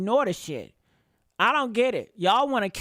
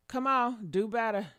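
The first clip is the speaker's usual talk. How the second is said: normal talking voice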